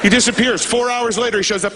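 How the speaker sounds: ordinary delivery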